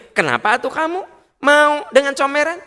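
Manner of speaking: high, sneering retort from the husband; husband hitting back. Acted. Husband throwing the insult back at his wife, mocking high retort. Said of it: "suami kalau ngebalikin".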